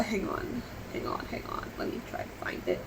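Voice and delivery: whispered mutter to self; barely audible, searching; muttered under breath. Real, not acted.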